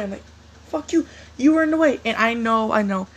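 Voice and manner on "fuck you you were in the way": higher, louder snap back in a quarrel; retold angry retort. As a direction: fire back indignantly, pitched up. Retelling his heated retort.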